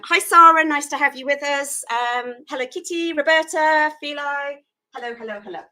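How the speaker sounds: usual speaking voice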